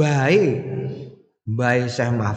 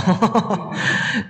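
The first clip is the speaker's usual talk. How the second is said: chuckling; 5 semitones higher than usual. Real, not acted. Laughs after mimicry.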